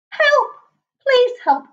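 high, loud cry for help. Acted. Bush baby running to the porcupine, crying for help. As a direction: call out desperately. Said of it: "cried Basil".

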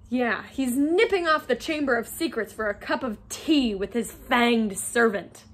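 usual speaking voice

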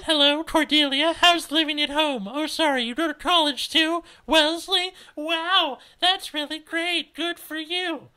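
loud, sugary-high fake enthusiasm; mocking squeaky-sweet greeting; high-pitched condescending mimicry. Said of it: "almost word for word what she acted out".

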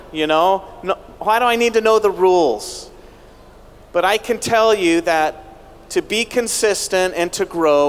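ordinary talk

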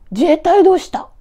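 high, loud scolding question; scolding mother. Acted. Mother scolds her son for leaving the army, loud and high. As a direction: snap at him like a mother. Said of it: "お母さんが彼を叱る".

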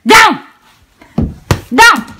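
loud, high one-word order; short high shouted command; dog command. Real, not acted.

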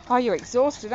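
ordinary talk

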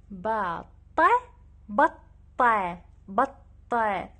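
بطة said slowly and loudly, ending on a; slow stressed batta. Shown as a demonstration. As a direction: stretch out بطة, ending on a long a. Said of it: "أشيل التنوين". (بطة ببطء وبصوت عالٍ تنتهي بالألف)

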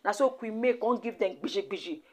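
ordinary talk

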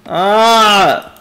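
loud, drawn-out "ah" of fright; frightened cry. Real, not acted. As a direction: cry out in alarm. Frightened by the game.

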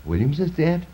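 high, startled disbelief; shocked question. Acted. Poet stopping short at the news of the death, stunned. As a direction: jump up in pitch, can't believe it.

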